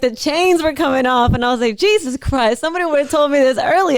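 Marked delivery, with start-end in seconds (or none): 1.8-4.0 elated. Retold or imitated. Retelling his own elated thoughts.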